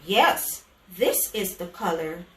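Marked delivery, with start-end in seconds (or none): none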